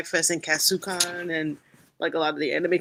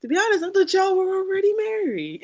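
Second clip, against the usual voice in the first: quoting a friend; 13 semitones higher than usual. Retold or imitated. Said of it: "He's like".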